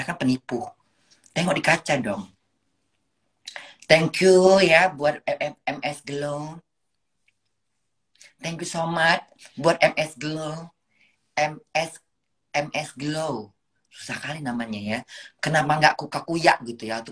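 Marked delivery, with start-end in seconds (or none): 3.9-13.5 slow, drawn-out sponsor thanks. Pretend. Mother thanking her skincare sponsor, slowly. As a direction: stretch each word of the thanks.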